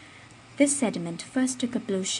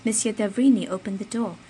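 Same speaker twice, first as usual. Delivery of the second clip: ordinary delivery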